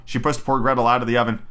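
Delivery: ordinary delivery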